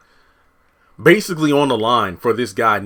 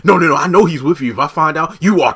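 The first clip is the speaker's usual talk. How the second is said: higher, louder menacing phone threat; mock threat; 6 semitones higher, 8 dB louder than usual. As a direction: lean in and threaten him. Donald threatens Dale by phone.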